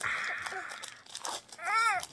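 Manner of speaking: put-on high, soft, stretched ah for the baby; baby-talk ah. Shown as a demonstration. Dad coaxing his baby to say ah, high and soft. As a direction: coax the little one to copy it.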